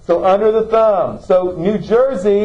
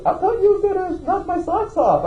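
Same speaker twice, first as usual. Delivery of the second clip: imitated confused man; 13 semitones higher than usual. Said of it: "He goes".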